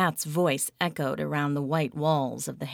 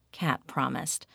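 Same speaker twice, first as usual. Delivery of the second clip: ordinary delivery, nothing marked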